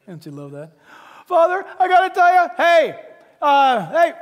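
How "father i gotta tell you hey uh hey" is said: loud, high, fast hailing of the servants; father hollering over his son; eager father shouting for attention; calling out. Acted.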